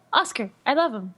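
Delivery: gasp, then fast gushing fan; gushing fangirl. Retold or imitated. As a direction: tease her as an obsessed fan. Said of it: "you were like".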